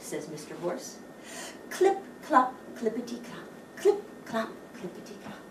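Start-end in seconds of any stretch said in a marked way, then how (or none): none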